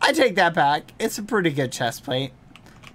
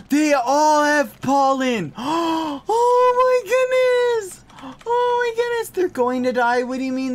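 much higher, louder gasping awe; amazed squeal; 10 semitones higher, 5 dB louder than usual. Real, not acted. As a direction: gasp in delight at the bees. Amazed at pollen bees.

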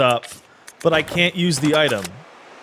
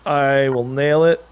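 drawn-out, cocky promise; slow confident boast; much slower than usual. Real, not acted. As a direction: stretch each word, cocky.

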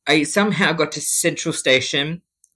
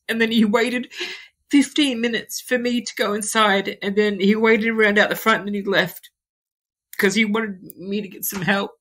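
tearful, voice pitched up and breaking; holding back tears while retelling the day; crying through the words; crying while telling; 6 semitones higher than usual. Real, not acted. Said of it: "I'm sorry for crying".

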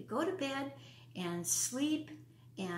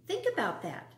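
plain reading voice

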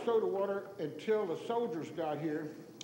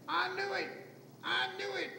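very high, repeated outraged cry; mock scream; 12 semitones higher than usual. Customer sure the end has come, mock screaming. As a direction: wail it high like a doom-struck customer. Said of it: "And he started screaming".

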